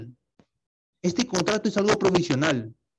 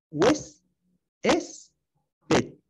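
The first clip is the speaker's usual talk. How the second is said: Hues- es pet, split into separate syllables with a pitch jump on the middle one; 5 semitones higher, much slower than usual. Shown as a demonstration. Teacher splitting huésped to show where the accent goes, syllable by syllable. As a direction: break huésped apart to find the stressed syllable. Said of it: "Ahí es donde lleva la tilde".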